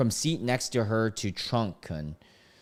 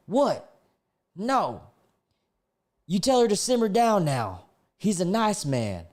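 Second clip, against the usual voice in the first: higher-pitched acted passenger; lightly put-on, higher voice for a character; voiced passenger; 9 semitones higher than usual. Passenger telling the trunk to simmer her down, in a higher acted voice.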